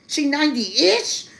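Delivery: rising, lightly voiced mock astonishment; a hint of staring at grandma in disbelief; shocked disbelief. Retelling her shock at grandma, mildly high disbelief. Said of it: "I was like".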